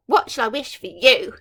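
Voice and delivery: loud, sinister villain question. Acted. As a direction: tease the baby with menace. Villain leans over cradle.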